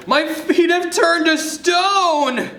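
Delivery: high, slow, loud panicked cry; panicked wail.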